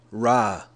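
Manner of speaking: Ra said alone as rah, like a lion; syllable demo. Shown as a demonstration. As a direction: sound out Ra. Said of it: "like a lion".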